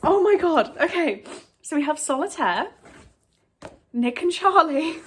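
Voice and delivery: excited. Real, not acted. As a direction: rattle off the books in disbelief. Listing gifted books.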